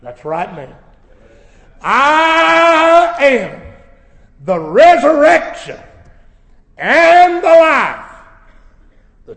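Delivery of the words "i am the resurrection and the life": high, loud, slow proclamation; voice of Jesus. Acted. Jesus declaring himself to Martha, loud and slow. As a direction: proclaim it with full authority. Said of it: "Jesus said unto her".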